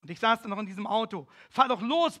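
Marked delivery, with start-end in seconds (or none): 1.5-2.2 loud, higher retold bark at the driver; officer yelling at the driver, loud retold order; bellowed order. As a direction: yell at the driver to get going. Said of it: "brüllte mein Kerl dem Fahrer zu".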